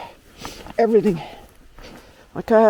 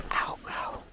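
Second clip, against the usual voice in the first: pained whisper; 16 dB quieter than usual. Real, not acted. Hiker in pain, sore knee.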